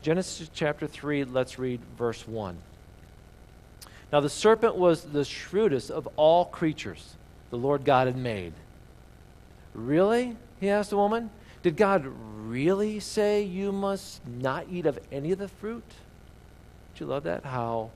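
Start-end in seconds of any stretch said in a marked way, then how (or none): 4.1-15.8 reading the Bible passage aloud to the congregation; reading scripture to the church. Acted. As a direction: give the verses a storyteller's lift.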